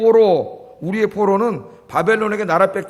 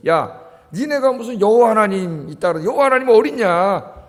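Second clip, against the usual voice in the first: jeering challenge, building louder and higher; acted heckling of a defeated people; mocking taunts; 4 semitones higher, 4 dB louder than usual. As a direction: taunt them with scornful questions, rising to a shout. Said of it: "조롱했다는 거예요".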